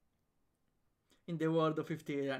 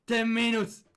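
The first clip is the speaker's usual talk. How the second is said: soldier callout; 5 semitones higher, 6 dB louder than usual. Acted.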